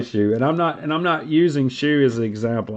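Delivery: ordinary delivery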